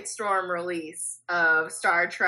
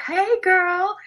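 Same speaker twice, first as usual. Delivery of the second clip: flirty; 11 semitones higher, 4 dB louder than usual. Acted. Flirty greeting to the screen.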